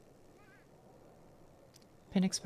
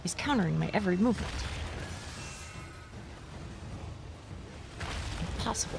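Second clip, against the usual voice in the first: anime villain monologue; 6 dB quieter, much faster than usual. Acted. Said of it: "anime villain doing a monologue voice".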